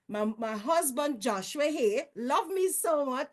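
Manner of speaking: ordinary talk, nothing marked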